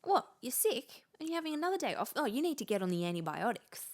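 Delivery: put-on high nagging older relative. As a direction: chide like an old-fashioned parent. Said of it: "the older people in my life say".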